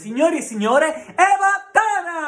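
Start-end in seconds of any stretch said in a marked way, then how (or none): 1.1-2.3 announcer call. Acted. Host announces guest.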